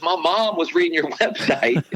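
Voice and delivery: a hint of a Southern country twang. Coach explaining, in a light Southern twang. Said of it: "and he's got the twang going".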